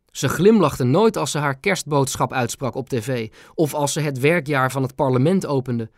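ordinary delivery, nothing marked

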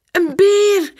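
put-on high, loud little-girl exclamation; sudden excited cry. A narrator's reading. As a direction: blurt it out loudly in a small child's high voice. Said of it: "roept Pum ineens".

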